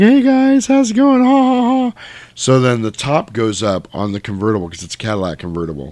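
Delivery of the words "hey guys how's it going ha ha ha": high, loud mock-jolly greeting; hammed-up cheer ending in fake laughs; laughing friends. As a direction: imitate giddy teens hollering hello. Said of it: "they're all laughing".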